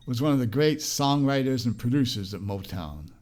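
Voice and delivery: ordinary talk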